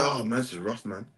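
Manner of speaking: low, soft mock grumble; sighing complaint. Preacher voicing the oppressed people's sigh, low mock complaint. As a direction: imitate a weary sigh of hardship. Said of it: "We sighed".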